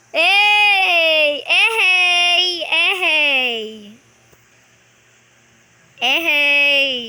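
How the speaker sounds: loud, repeated triumphant whoops; excited cheering. Real, not acted. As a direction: cheer the play at full voice. Celebrating a play in game.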